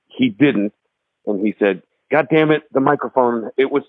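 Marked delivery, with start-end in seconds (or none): 2.1-3.8 higher-pitched retold annoyance; kinda mad. Singer complaining about the slipping microphone, imitated as kinda mad. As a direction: grumble about it in a raised pitch, imitating him. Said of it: "he kinda got mad".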